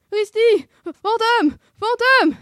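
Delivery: very high, loud yelps; Scooby warning cry. A narrator's reading. Scooby warns Sammy of the ghost, shrieking in alarm. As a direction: yelp the warning in panic. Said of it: "l'avertit Scooby".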